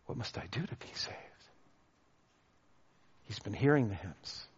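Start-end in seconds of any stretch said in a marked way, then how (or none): 0.1-1.2 hushed question. Retold or imitated. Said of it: "But then he asks".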